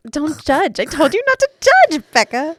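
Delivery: high, loud playful scolding; high mock-indignant protest. Real, not acted. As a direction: squeal it out in mock outrage. Mock-indignant at friend's judging.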